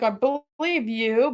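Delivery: very slow, stretched "believe", rising; drawn-out placating. Retold or imitated. Bosses humour the employee before refusing, drawn-out placating. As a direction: stretch "believe" out, imitating a patronising boss.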